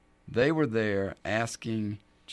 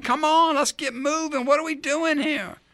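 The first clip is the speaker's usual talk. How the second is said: much higher, urgent and pushy; impatient father; 5 dB louder than usual. Pretend. Jairus urging Jesus to hurry to his dying daughter, impatient. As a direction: hurry everyone along, desperate. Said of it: "If I'm Jairus, I'm saying".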